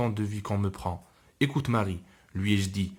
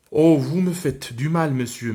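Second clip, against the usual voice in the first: put-on child's cry of pain; 4 semitones higher, 9 dB louder than usual. Girl cries out in pain.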